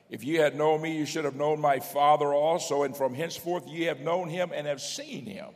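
high, loud pulpit reading; preacher declaiming from the page; scripture proclamation. Acted. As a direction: ring the verse out to the back pew.

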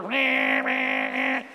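imitated blaring car horn; car horns honking. Cars stuck behind a proposal, honking imitation. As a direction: honk like backed-up traffic. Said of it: "and they're honking".

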